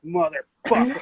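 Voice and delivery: drawn-out, seething curse, imitating dad; mock an angry dad gripping the wheel; retold pissed-off curse. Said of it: "Just like, hella pissed off".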